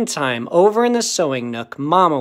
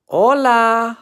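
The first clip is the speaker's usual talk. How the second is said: loud, high call back; raised storybook holler, held long; hollered greeting; 7 semitones higher, 6 dB louder than usual. A narrator's reading. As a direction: holler it out to the whole gang. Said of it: "hollered back Skipito Fresquito".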